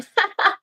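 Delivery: high giggling burst; burst of laughter. Real, not acted. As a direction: laugh out loud at yourself. Laughs at herself.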